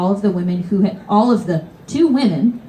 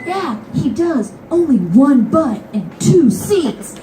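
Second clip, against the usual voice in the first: high, slow gloating agreement; drawn-out smug crowd chorus; crowd agreeing; 7 semitones higher, slower than usual. Retold or imitated. Passengers siding with the child against the seat hog, gloating.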